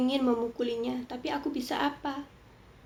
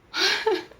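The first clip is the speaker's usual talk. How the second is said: high burst of laughter; 9 semitones higher, 4 dB louder than usual. Real, not acted. Laughs at the excuse.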